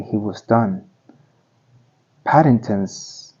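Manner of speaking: plain reading voice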